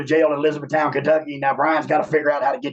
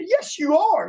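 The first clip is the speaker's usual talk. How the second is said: defiant insistence; 13 semitones higher, slower than usual. Retold or imitated. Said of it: "I said".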